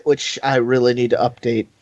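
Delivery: ordinary talk, nothing marked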